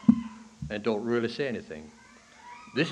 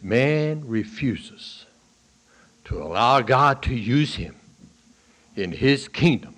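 full-force preaching; 2 semitones higher, 8 dB louder than usual. Real, not acted. Preacher explaining Romans nine to the church, booming. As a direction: thunder the warning from the pulpit.

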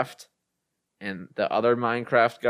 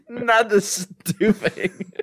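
laughing mock despair; 8 semitones higher, much slower than usual. Mock horror about stuffing.

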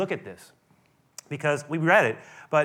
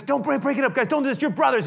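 Moses breaking up fight; 8 semitones higher, 4 dB louder than usual. Retold or imitated. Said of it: "He, he says".

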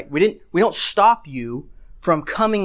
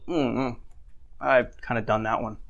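low, quiet reluctant confession; sheepish admission; 6 semitones lower, 7 dB quieter than usual. Retold or imitated. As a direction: own up under your breath. Imagined listener sheepishly admits guilt.

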